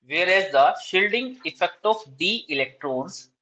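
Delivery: slow, deliberate dictation, each phrase spaced out. Shown as a demonstration. Teacher dictating exam answer to students, slow dictation. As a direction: read out notes word by word for students to copy.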